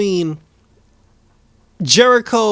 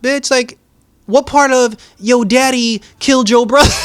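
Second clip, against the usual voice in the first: higher, louder mocking comeback; talking back to Rose, higher and loud; incredulous retort; 7 semitones higher, 5 dB louder than usual. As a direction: scoff at her logic. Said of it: "I'm just like".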